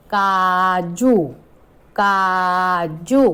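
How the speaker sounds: very slow, काजू with the ऊ stretched long; long drawn ऊ. Shown as a demonstration. Teacher showing the long vowel to children, slow. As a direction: draw out the ऊ in काजू. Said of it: "बड़े ऊ की मात्रा".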